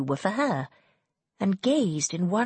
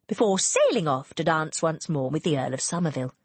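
usual speaking voice

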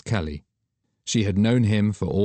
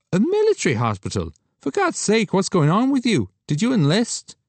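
higher, louder echo of her words; incredulous; 13 semitones higher, 4 dB louder than usual. Acted. Friend stunned by her answer, higher disbelieving echo. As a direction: repeat it in disbelief.

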